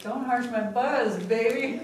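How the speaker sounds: high, loud, slow mock whine of "don't harsh my buzz"; hammed-up impression of the touchy friend; mock friend's protest. Mocking her friend who dislikes negativity, loud high drawn-out whine.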